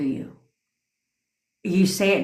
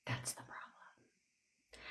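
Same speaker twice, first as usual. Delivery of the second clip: very soft, slow whisper; whispered aside; 27 dB quieter, much slower than usual. Real, not acted. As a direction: whisper it slowly, almost voiceless. Aside after making her point.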